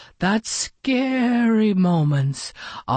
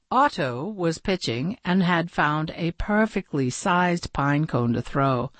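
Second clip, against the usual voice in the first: ordinary delivery, nothing marked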